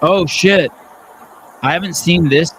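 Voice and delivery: loud, high-pitched startled outburst; real shock. Real, not acted. Viewer reacting to unseen police footage, real shock. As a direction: exclaim it, suddenly taken aback. Startled by bodycam video just playing.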